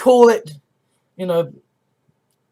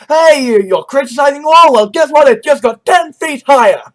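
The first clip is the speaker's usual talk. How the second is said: higher, louder mock comeback; defiant retort; 4 semitones higher, 5 dB louder than usual. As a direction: bark it back at the critic. Trump-style retort acted out.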